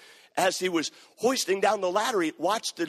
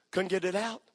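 usual speaking voice

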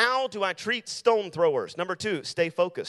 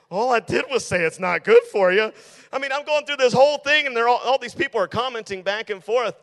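higher and louder, worked up and exasperated; venting how absurd it got, rising and loud; 6 semitones higher, 7 dB louder than usual. Real, not acted. Preacher venting to the youth group about a Facebook pile-on, exasperated and loud. Frustrated retelling of Facebook fight.

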